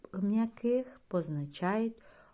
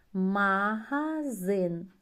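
магазин slow and loud; slow model word; slow reading; 3 semitones higher, 5 dB louder, much slower than usual. Shown as a demonstration. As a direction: read it syllable by syllable. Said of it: "Спробуємо прочитати слова".

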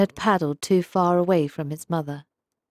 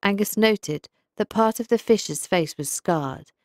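ordinary delivery, nothing marked

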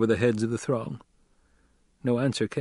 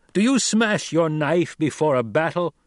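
higher, louder, pointed demand; 6 semitones higher, 4 dB louder than usual. A narrator's reading. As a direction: press the crowd hard. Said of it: "Paul demanded".

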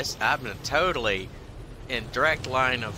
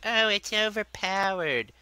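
high-pitched whiny complainer; mock whining; 8 semitones higher than usual. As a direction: mimic a whiner, stretched and high. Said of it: "bitched and whined".

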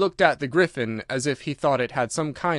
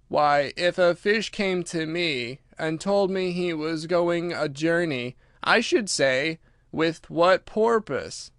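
slow, drawn-out creature, slightly higher; Mock Turtle, ponderous; 4 semitones higher, slower than usual. Mock Turtle explains to Alice, slow and ponderous. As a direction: spell out the case very slowly.

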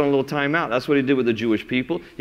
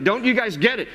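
higher, urgent plea to turn back; pleading prophet; 7 semitones higher than usual. Retold or imitated. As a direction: beg them to turn before it's too late. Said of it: "He's like".

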